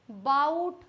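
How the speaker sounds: बाउट as "bowt", offered as one option; variant pronunciation. Shown as a demonstration. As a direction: say बाउट with an "ow" diphthong. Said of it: "क्या बोलते हो".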